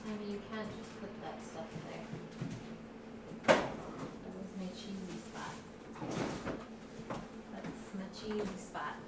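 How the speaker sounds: hushed, fast telling-off of the dog; softly scolding the dog for taking his spot. Real, not acted. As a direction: scold the dog under your breath.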